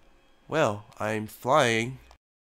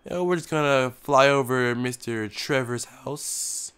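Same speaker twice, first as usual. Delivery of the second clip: usual speaking voice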